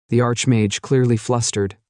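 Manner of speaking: ordinary delivery, nothing marked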